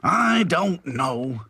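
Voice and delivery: slow, drawn-out complaint; complaining. A narrator's reading. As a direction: stretch it out in exasperation. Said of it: "he complained".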